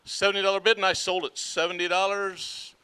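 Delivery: rhythmic auction chant; auctioneer. Acted. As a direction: rattle off the bids in a steady chant.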